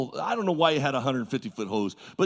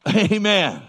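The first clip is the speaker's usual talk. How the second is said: loud, higher call for a response; louder prompting; 5 semitones higher, 6 dB louder than usual. Real, not acted. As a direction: call it out to rouse the congregation. Repeats louder to prompt congregation.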